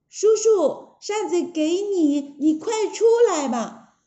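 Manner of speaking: high, coaxing, whiny plea; high-pitched storybook pleading; pleading princess. Acted. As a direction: beg sweetly as the beaten princess.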